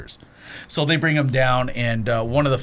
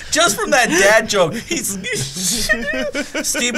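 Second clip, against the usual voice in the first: real laughter breaking through the words; can't get through the story for laughing; 13 semitones higher than usual. Real, not acted. Laughing at his own retold joke.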